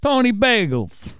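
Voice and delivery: gangster voice, drawn out slowly; mafia voice. Pretend. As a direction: say it like a tough New York mobster. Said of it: "I use my mafia voice".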